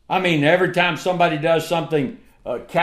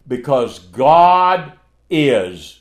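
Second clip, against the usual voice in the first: slow, weighty and firm; slow proclamation; 3 semitones lower, 3 dB louder, much slower than usual. Real, not acted. Preacher declaring God's permanence, slow and weighty. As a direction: lean on every word slowly.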